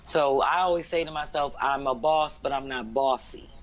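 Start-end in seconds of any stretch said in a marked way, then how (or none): none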